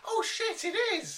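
sudden high, loud oh shit; surprised. Real, not acted. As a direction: realise it all at once. Sudden recognition of the actress.

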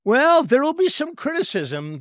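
high, loud playful objection; emphatic protest. Real, not acted. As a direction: jump in loudly to push back, half joking. Playful objection to host's remark.

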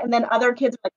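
ordinary talk

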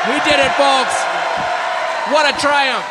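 loud, high mock victory shout; hammed-up celebration, shouted high; mock triumphant shout. Mock victory after eating dish.

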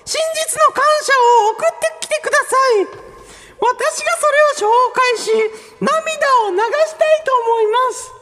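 high, hammed-up plea; impassioned appeal. Host asks listeners for true gratitude, high dramatic appeal.

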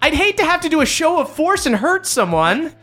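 high, chipper golly voice; cheerfully menacing sidekick; goofy hero twin. Acted. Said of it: "his twin, goes".

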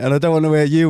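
ordinary delivery

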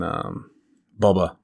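usual speaking voice

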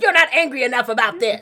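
mock indignant. Arguing with mom on phone.

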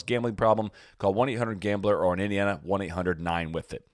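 upbeat sponsor read. Acted. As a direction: read it like a podcast ad. Ad read in podcast.

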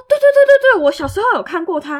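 高声连喊“对对对”; 兴奋地大声抢着确认; loud eager yes. Retold or imitated. Coworker eagerly confirms. (同事兴奋地高声连连确认)

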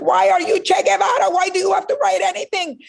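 high, exasperated parent voice; mock-frantic parents scolding a rebellious daughter. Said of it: "they're like".

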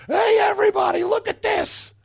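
high, loud mocking shout. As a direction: call it out to the whole airport. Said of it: "they go".

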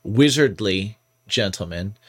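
ordinary delivery

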